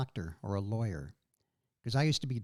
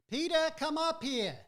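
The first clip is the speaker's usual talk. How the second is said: higher mother voice calling from the stairs; mom calling; 12 semitones higher than usual. Retold or imitated. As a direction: summon him upstairs like his mom. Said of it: "she's like".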